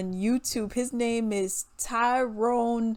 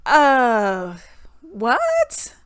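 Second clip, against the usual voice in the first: baffled reaction; 5 dB louder than usual. Retold or imitated. Reacting to gushing comments, baffled and incredulous. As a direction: stretch the uh, then pop up incredulous. Acted bafflement at fans' praise.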